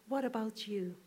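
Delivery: ordinary talk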